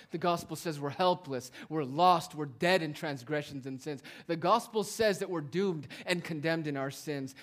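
impassioned sermon voice; full-force preaching. Real, not acted. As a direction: drive the point home to the congregation.

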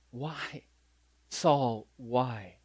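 very soft, falling, spaced-out plea; soft words. Acted. Jesus asks his persecutor a question in mercy, very softly. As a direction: ask it gently, barely voiced, with pauses. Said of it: "he speaks to him with soft words".

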